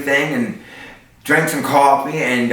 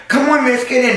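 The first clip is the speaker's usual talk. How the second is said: pushy man in a car; 11 semitones higher than usual. Retold or imitated. Man in car pressing her.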